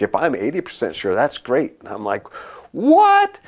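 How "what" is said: very high, loud squawk of disbelief; hammed-up astonished yelp; incredulous shriek. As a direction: blurt it out sky-high, comically appalled. Acted disbelief at boss's claim.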